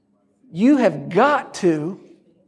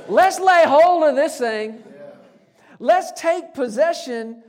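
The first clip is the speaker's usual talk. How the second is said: rallying cry; 7 semitones higher than usual. Acted. Preacher voices Caleb's rallying call.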